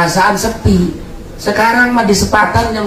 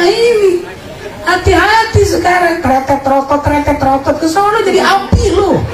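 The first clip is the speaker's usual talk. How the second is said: much higher, mocking warning; high teasing voice; 13 semitones higher than usual. Preacher teasing sinners with a high mocking warning. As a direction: tease them in a high put-on voice.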